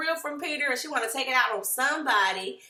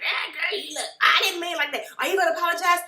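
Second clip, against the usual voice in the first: raised, louder imitation of a squabble; mock bickering; 7 semitones higher, 4 dB louder, faster than usual. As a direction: argue over each other, higher and loud. Acted back-and-forth quarrel.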